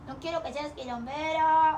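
talking to the puppy. Acted. Owner to her puppy, slow high baby-talk scold. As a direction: coo the scolding at the pup.

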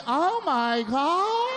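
high, slow, laughing oh my God; mock wife surprise. Mocking his wife pretending surprise at the missing fender. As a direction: stretch it high like fake shock.

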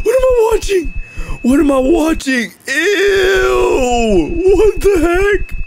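very high, squealing revulsion; appalled disgust. Real, not acted. Viewer appalled at what the video shows, squealed disgust. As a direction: squeal in horrified disbelief.

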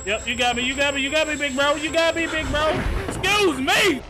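panicked high yelling; hyped gamer bro. Real, not acted. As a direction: squeal it out, caught and giving up. Caught by enemy, panicked.

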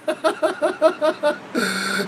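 high, breathy giggle; a quick high giggle; high laugh. Real, not acted. Vlogger laughing at food chemicals, high giggle.

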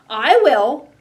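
loud, high quacky shout; eager duck voice. Acted. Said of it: "quacked the duck".

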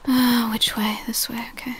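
whispered question. Real, not acted. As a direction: whisper it, unsure. Whispered aside while sneaking.

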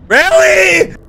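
high loud fed-up yell; exasperated shout. Real, not acted. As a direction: yell it at the screen in disbelief. Second take, yelled.

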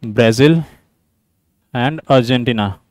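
low, soft, word-by-word dictation; slow dictation. Real, not acted. Teacher reading out a quiz statement for students, slow dictation. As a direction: dictate slowly with long gaps between words.

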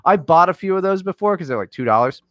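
ordinary talk, nothing marked

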